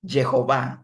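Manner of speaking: Jehová as "Ye-ho-VÁ", y sound at the start; older pronunciation. Shown as a demonstration. Teacher repeating the old form for the class, Jehová as "Ye-ho-vá". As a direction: repeat Jehová with "ye-ho" and a held final á. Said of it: "así se pronunciaba".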